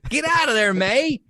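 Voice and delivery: high, loud, pleading shout for May to run; yelling at Aunt May to get out, like at the screen; frantic yelling at the screen. Retold or imitated. A fan yells at Aunt May on screen, high and panicked. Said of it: "And I'm like".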